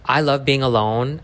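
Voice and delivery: usual speaking voice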